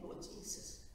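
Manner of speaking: ordinary talk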